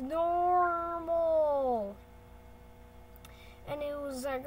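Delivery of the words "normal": higher, loud, stretched-out "normal"; drawn-out announcement. Acted. Narrator declaring the new heart rate, drawn out. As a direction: hold the word like a long held note.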